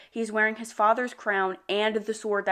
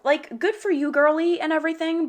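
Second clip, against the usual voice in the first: sarcastic praise; 8 semitones higher, 4 dB louder than usual. Real, not acted. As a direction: cheer with an ironic edge.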